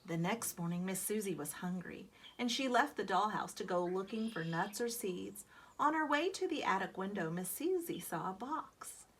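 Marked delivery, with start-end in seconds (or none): none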